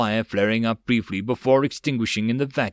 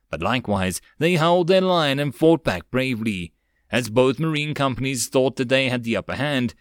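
plain reading voice

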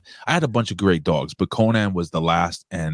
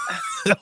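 big laugh; 3 dB quieter than usual. Real, not acted. Loud laugh after shark joke.

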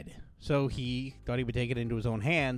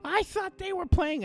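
much higher, whiny falsetto; mock ex-wife excuse. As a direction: play her defensive excuse in a squeaky voice. Ex-wife's excuse, voiced mockingly.

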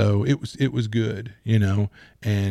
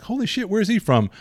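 much higher, startled exclamation; mock shock; 10 semitones higher than usual. Hearing an actor's real accent in an interview, mock shock. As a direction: blurt it out like you just found out.

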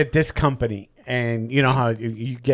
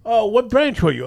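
mock interviewer; 9 semitones higher than usual. Interviewer voiced in retold story.

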